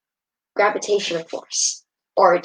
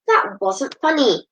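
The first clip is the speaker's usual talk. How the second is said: angry; 7 semitones higher than usual. Acted. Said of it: "Christy's voice sounded very angry".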